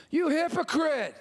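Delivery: lightly acted accusing retort; Jesus. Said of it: "Jesus' response".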